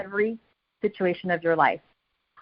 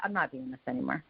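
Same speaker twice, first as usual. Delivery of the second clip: defeated inner voice; 8 semitones lower, 10 dB quieter, faster than usual. Retold or imitated. Retold giving-up thought.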